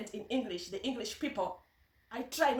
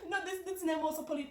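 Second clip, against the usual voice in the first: higher voice through laughter; laughing; 6 semitones higher, faster than usual. Real, not acted. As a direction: talk through the tail of a laugh. Laughing about the name.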